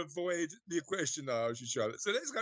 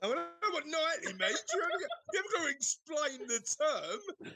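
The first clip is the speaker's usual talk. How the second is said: higher-pitched, laughing objection; amused and incredulous, pitched up; laughing protest; 9 semitones higher than usual. Real, not acted. Amused teasing over a word.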